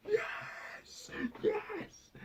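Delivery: high squealing cry of yes; high-pitched squealing yes yes; squealed yes. Retold or imitated. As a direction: shriek for joy. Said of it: "I'm there like".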